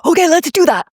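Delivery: imitating a rattled yes; mock anxious reply. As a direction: squeak it like you're caught up in the panic. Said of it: "which would have made me go".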